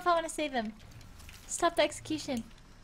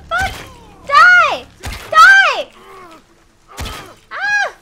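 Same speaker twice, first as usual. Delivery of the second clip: much louder, higher shouts and screams; battle shouting; 19 dB louder, much slower than usual. Real, not acted. Streamer fighting an enemy, shouting and screaming. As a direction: yell it in combat.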